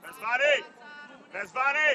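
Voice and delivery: loud rising name call. Real, not acted. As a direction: call a distant player by name. Coach calls player by name. (strigă tare numele, cu ton urcător)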